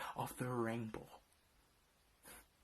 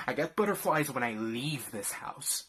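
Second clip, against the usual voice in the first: usual speaking voice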